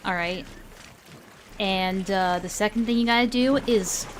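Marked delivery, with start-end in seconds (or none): none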